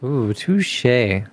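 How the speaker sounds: normal talking voice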